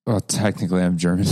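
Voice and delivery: ordinary talk, nothing marked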